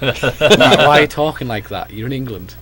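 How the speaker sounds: loud, high, incredulous retold protest; family's exasperated scolding. Family scolding him over his accent, loud incredulous outburst. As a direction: throw it back at him like a fed-up parent. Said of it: "and they're like".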